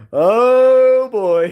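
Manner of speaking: high, loud, drawn-out exclamation; hammed-up dismay; put-on voice. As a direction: wail it out in a silly voice. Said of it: "with the voice I'm gonna do".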